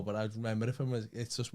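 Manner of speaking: ordinary talk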